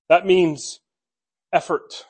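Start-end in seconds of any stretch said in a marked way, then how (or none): none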